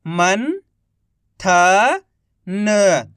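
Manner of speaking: each syllable drawn out very slowly; slow syllable-by-syllable reading. Shown as a demonstration. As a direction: sound out सुरंग slowly as सु-रं-ग, one sound at a time. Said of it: "एक अक्षर का उच्चारण करके".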